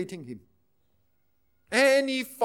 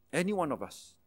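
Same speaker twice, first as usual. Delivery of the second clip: soft echo; 7 semitones lower, 10 dB quieter than usual. Acted. Emphatic point then quiet echo.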